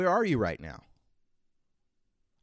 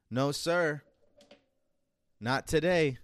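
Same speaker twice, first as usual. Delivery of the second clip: defiant; 5 semitones higher, 5 dB louder, slower than usual. Acted. Defiant answer to the old self.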